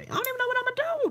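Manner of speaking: squeaky high voice, sped up; high falsetto. Real, not acted. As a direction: float it up into a silly falsetto. High falsetto musing on birthday.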